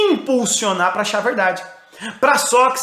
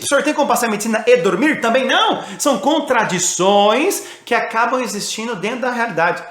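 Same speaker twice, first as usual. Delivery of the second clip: ordinary talk, nothing marked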